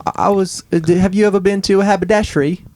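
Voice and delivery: high, quick English accent. Shown as a demonstration. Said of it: "then I go right into English".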